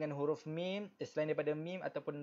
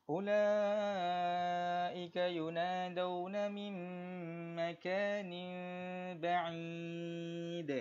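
slow, higher melodic chant, now flowing cleanly; corrected recitation; 4 semitones higher, much slower than usual. Shown as a demonstration. Teacher rereading the verse correctly after a mistake, slow chant. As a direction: recite it again properly after the slip. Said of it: "Saya ada tersilap baca tadi".

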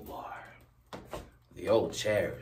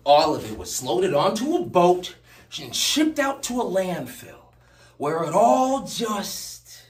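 raised, loud, swelling outcry, slowing at the end; anguished build; 9 semitones higher, 12 dB louder than usual. Acted. Speaker tells Jack all went to landfill, crying out. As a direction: push higher and louder with each phrase, then hang.

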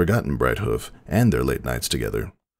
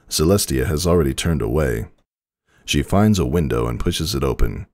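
ordinary delivery, nothing marked

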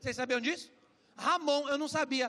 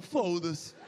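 put-on Portuguese accent; 8 semitones lower than usual. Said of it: "Eles falam".

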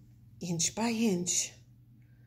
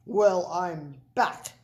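slow, lightly growled bully dog; a hint of a menacing dog growl; 4 dB louder than usual. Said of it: "growled Big Bark".

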